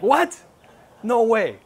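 high, loud cry of disbelief; stunned disbelief. Retold or imitated. Recalling his friend's news, a high loud amazed cry. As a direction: shoot up in pitch, amazed, can't believe it. Hearing friend got born again.

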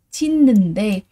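"Chít nần đê" with a clear d sound at the end and a doubled n; correct reading. Shown as a demonstration. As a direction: say "Chít nần đê" with n carried over and a d ending. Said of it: "Vì vậy từ này mình sẽ đọc là gì?".

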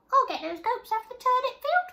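very high, light little bee; tiny bee voice. Acted. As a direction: squeak it like a small buzzing bee. Bee offers to help.

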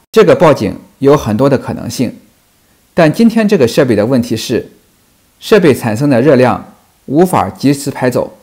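ordinary talk, nothing marked

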